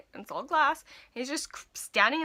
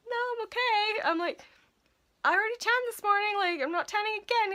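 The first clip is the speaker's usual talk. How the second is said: high-pitched acted-out excuse; polite refusal; 12 semitones higher than usual. Clerk turning down a customer's invitation, high polite excuse. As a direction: imitate yourself begging off in a high voice.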